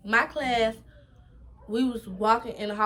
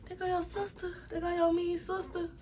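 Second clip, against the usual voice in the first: teasing voice; 8 semitones higher, 7 dB quieter than usual. Retold or imitated. Friend's taunt voiced.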